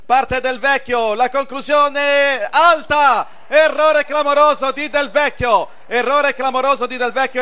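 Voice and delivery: slow, rising penalty call; excited penalty call. Real, not acted. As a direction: stretch the miss out, dismayed. Live penalty call, missed.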